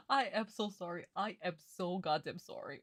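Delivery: ordinary talk, nothing marked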